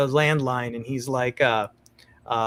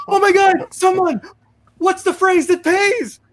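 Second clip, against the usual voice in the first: much higher, louder desperate shout door to door; frantic shouting; 4 dB louder than usual. Retold or imitated. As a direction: beg the neighbours in panic.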